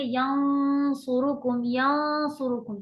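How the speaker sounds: यंसुरुकुम slow, noon held heavy and nasal; slow tajweed recitation; heavy ghunna. Shown as a demonstration. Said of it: "हम पढ़ेंगे".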